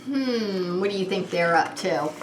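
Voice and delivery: plain reading voice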